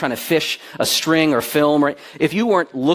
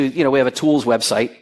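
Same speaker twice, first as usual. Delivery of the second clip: ordinary talk, nothing marked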